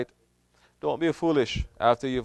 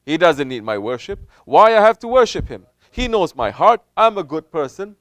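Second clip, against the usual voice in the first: raised, louder whiny doubter voice; mock doubter; 8 semitones higher, 9 dB louder than usual. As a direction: play the questioning doubter, pitched up. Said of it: "Pretty soon you're gonna say".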